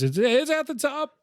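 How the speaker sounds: high, cracking voice. Retold or imitated. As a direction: let the voice crack on top. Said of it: "LaBelle's voice cracks where he goes".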